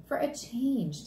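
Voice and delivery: lightly voiced father, slow; explaining dad. Dad answering Fudge about moving, slowly explaining. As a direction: explain it simply and slowly to a child. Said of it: "Dad explained".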